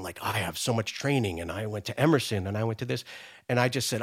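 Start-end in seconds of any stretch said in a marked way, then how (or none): 0.2-3.0 entitled actors. Retold or imitated. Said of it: "entitled and saying".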